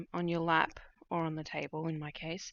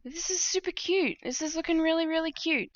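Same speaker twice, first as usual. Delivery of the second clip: excited; 11 semitones higher, 6 dB louder than usual. Real, not acted. Admiring her finished stitching.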